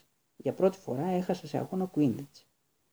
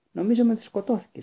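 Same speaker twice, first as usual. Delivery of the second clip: higher, louder frightened cry; trembling fear; 8 semitones higher, 6 dB louder than usual. A narrator's reading. Alicia fears the fallen seeker is dead, trembling higher and louder. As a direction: blurt it out shaking with fear. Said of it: "συμπλήρωσε τρέμοντας η Αλίσια".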